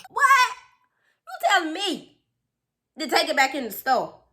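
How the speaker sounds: high, loud incredulous question; shocked disbelief. Acted. Child stunned at being sent back, incredulous. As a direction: squeal it in mock outrage.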